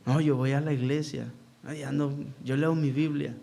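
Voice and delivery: a hint of a sanctimonious youth, hushed; mock pious youth. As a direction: imitate a self-satisfied young believer, softly. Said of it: "Imagínense qué cosa, estos jóvenes".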